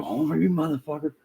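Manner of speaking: high-pitched exasperated dog scolding; imitating himself yelling at the dog; re-enacted cry of frustration; pissed off. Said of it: "When I'm pissed off".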